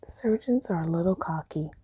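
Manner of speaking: soft unvoiced whisper; whispered confession. Real, not acted. Telling viewers a cheeky opinion, whispered. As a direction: whisper it like a secret. Confiding opinion about surgeons.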